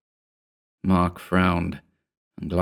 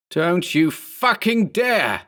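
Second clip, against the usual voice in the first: higher, slow warning yell; 10 semitones higher, slower than usual. A narrator's reading. Berman yells as he lunges at the clone. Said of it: "Berman yelled".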